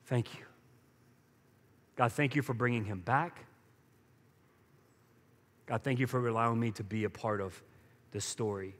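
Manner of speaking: soft, low, thankful murmur to God; a father quietly thanking God; grateful prayer. Retold or imitated. A father praying thanks as his son returns, soft and low. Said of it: "an attitude of gratitude where he says".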